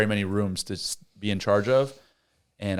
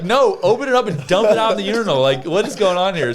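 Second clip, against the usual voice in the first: higher, louder exasperated command; mock exasperated order; 9 semitones higher, 5 dB louder than usual. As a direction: order him around, fed up. Ordering kid to dump it.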